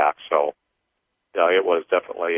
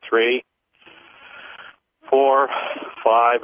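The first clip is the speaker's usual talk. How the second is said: paced counting; 5 semitones higher, much slower than usual. Acted. Leads a clearing count.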